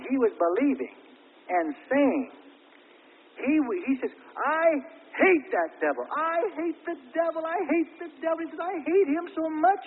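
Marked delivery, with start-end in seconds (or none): 4.3-9.8 high, loud cry of hatred; quoted angry outburst. Retold or imitated. Preacher voicing a repentant brother's outburst, high and loud. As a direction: voice the brother's fierce hatred of the devil.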